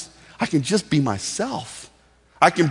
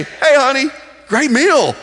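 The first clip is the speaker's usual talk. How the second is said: loud, much higher, chirpy everyday praise; upbeat casual call; 10 semitones higher, 7 dB louder than usual. Acted. Husband thanking his wife for dinner, loud and upbeat. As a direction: call it out to your honey.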